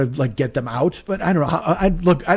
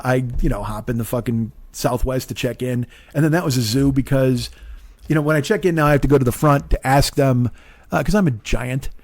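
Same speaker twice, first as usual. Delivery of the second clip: ordinary delivery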